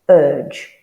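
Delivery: "urge" said loud, the ur vowel stressed. Shown as a demonstration. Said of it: "that I sound as".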